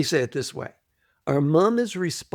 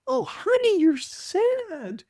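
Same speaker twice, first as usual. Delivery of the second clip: soothing mother voice; slower than usual. Retold or imitated. Mother soothing her sad baby, high and gentle. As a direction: comfort the infant in a sing-song coo.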